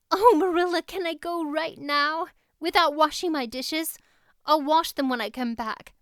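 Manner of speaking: put-on high, loud, breathless girlish rush; thrilled outburst. A narrator's reading. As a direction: beg eagerly, too thrilled to wait. Anne bursts out, thrilled.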